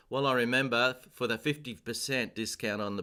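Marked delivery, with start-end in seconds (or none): none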